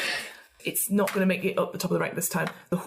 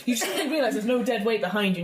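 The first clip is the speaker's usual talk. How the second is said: normal talking voice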